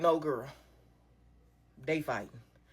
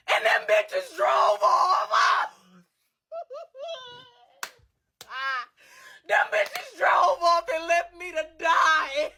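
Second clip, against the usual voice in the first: outraged yelling; 9 semitones higher, slower than usual. Real, not acted. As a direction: yell it, still furious at them. Outraged at friends leaving her.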